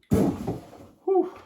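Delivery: loud, breathy exhale after exertion; breathless whew. Real, not acted. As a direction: blow out a winded whew. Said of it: "I'm getting out of breath".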